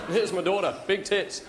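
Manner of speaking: put-on broad Australian accent, proud introduction; Australian bloke. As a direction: play an Aussie dad presenting his daughter. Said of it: "an Australian guy naming his kids".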